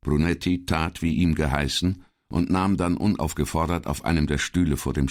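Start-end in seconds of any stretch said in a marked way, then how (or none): none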